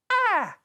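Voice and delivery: sharp high yelp of realisation; a loud, high gasp of sudden insight; sudden surprised cry. Real, not acted. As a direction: blurt out a startled ah. Sudden realisation mid-review.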